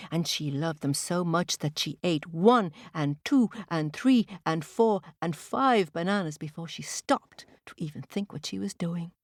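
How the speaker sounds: ordinary delivery, nothing marked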